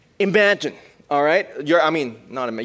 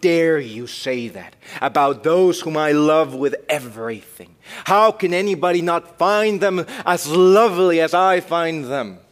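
louder, slow outrage; mock indignant; 5 semitones higher, 8 dB louder, slower than usual. Preacher acting the husband defending his family, indignant. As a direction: defend your loved ones with heat. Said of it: "He's gonna be angry".

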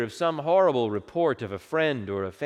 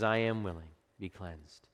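tender; 6 semitones lower, 7 dB quieter than usual. Acted. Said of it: "what tenderness must have been in Jesus' face and in his voice".

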